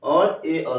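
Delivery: slow, deliberate listing of अ, आ, ए, ऐ; teacher drilling vowel sounds. Shown as a demonstration. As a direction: spell out each vowel sound slowly. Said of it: "ए मिलेगा अगर आपको".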